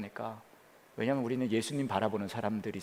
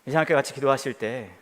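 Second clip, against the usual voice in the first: rising, fervent prayer voice over music, much higher and louder at the end; prayer leading; 7 semitones higher, 8 dB louder than usual. Acted. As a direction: build from a guiding prayer into full-force pleading.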